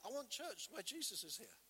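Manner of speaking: ordinary delivery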